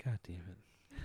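under-breath whisper. Real, not acted. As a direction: whisper it in dismay. Groaning aside at the joke.

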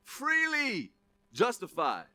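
high, loud, joyful declaration; God voiced. Preacher voicing God in heaven, high and loud. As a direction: announce it triumphantly as God. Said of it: "he's like".

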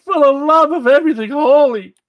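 loud, high exasperated cry; exasperated shout. Real, not acted. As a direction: plead loudly in mock despair. Exasperated at the R attempts.

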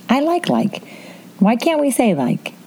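put-on high-pitched Valley Girl lilt; mock Valley Girl accent. Podcaster joking about overusing "like", mock Valley Girl. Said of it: "sounds like a Valley Girl".

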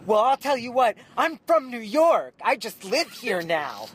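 put-on high, loud, slow drunken tirade; wasted and enraged, near tears; drunk angry rant. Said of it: "they get pissed".

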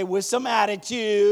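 slow, punchy call-out full of attitude. Shown as a demonstration. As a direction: get the whole congregation to say it with attitude. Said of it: "Say it with attitude".

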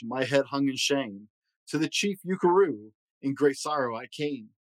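plain reading voice

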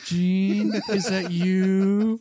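high, slow creepy call. Acted. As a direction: drag it out eerily. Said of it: "me walking into Target".

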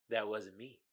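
low, hushed denial; quiet, low split-personality voice; deadpan creepy punchline. Acted. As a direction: deliver it very softly, deadpan. Reading the meme's punchline.

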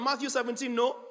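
ordinary talk

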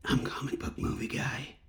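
dramatic whisper without the effect; breathy trailer whisper, natural voice. Acted. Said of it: "get to hear the comic book movie guy do it again".

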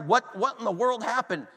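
thrown-back disbelief. Retold or imitated. Preacher retells being thrown back by her call, baffled. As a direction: replay the stunned reaction, voice lifted. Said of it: "thrown back, and I'm like".

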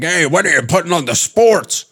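high, put-on uncle holler; yelling degenerate uncle. Sports-betting uncle from the corner, mock yelling. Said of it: "degenerate uncle that's in the corner that's yelling".

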